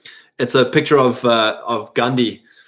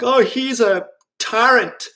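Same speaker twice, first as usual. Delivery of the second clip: much higher, slow, prissy mock alarm; shrill mock horror at a tyrant; mocking critic; 9 semitones higher than usual. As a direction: parody a scandalized critic. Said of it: "Mark Driscoll was like".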